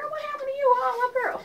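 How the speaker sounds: high teasing voice at the mannequin; mock scandalized. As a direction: tease her like a gossiping friend.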